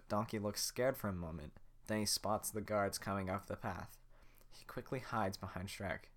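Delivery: usual speaking voice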